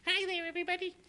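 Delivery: high falsetto woman's greeting; mock theater woman mask voice. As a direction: put on a squeaky lady voice. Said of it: "little women faces".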